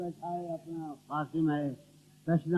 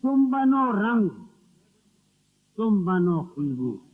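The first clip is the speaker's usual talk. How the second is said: ghazal recitation; 8 dB louder than usual. Acted. Poet delivering a couplet to the gathering, ringing verse recital. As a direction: declaim the couplet to the room.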